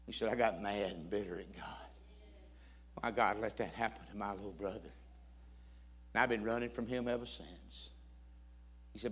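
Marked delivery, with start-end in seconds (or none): 0.3-7.9 low, soft retold confession; subdued and grave; somber confession. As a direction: confess old hurt quietly. Stranger's grieving confession retold.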